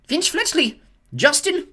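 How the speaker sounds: loud, high name call. Acted. McGonagall calls a pupil forward, loud and high. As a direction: call the name across the hall. Reading names to the hall.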